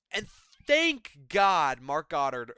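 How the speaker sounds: ordinary delivery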